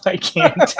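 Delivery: high-pitched burst of laughter; cracking up, can't go on; laughing. Real, not acted. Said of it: "I really tried".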